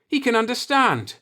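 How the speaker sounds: high excited burst; high, thrilled exclamation; exclaiming. A narrator's reading. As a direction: cry out with delight at the discovery. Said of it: "Ronal exclaimed".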